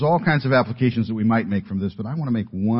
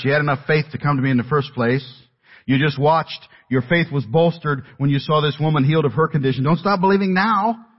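higher, energetic urging, rising at the end; urgent encouragement; 6 semitones higher, 4 dB louder than usual. Retold or imitated. Jesus urging Jairus to keep his faith, urgent encouragement. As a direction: press them earnestly to hold on.

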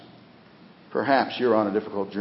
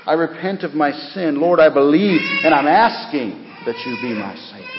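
raised, fervent prayer voice; model prayer; 6 semitones higher, 4 dB louder than usual. Shown as a demonstration. Preacher showing sinners how to ask Him, fervent prayer. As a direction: pray it out higher, with rising force. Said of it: "Say something like this".

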